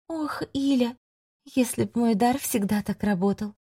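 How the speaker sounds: soft, sorrowful witch; quiet yearning lament; wistful sigh. A narrator's reading. Witch sighs softly.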